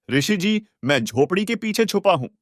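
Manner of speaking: put-on high, childlike voice calling from hiding; a young child speaking up, pitched high; little boy's voice. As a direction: voice the small boy calling out to the sage. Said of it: "बालक रूपधारी कबीर परमेश्वर".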